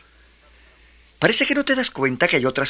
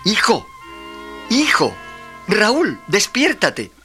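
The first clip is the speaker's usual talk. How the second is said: raised voice calling to wake someone; 6 semitones higher, slower than usual. Acted. Father waking sleeping son.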